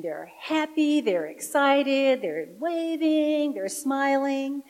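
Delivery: high, drawn-out, lilting list; joyful excitement. Real, not acted. Excitedly describing children running out to greet.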